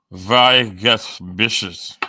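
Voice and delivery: loud, drawn-out mock German accent; bad German accent. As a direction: put on a slow, hammy German accent. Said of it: "I gotta get a better German accent".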